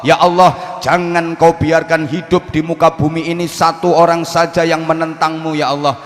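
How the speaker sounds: loud, pressing sermon voice; fiery preaching. Acted. Preacher voices Nuh's plea to God, loud and fiery. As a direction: drive the prayer home to the crowd.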